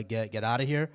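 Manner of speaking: normal talking voice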